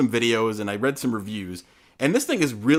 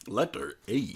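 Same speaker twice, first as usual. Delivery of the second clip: more robotic, soft and flat; a stiffer spelling-toy robot; 2 semitones lower, 8 dB quieter, slower than usual. Retold or imitated. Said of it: "even more robotic than that".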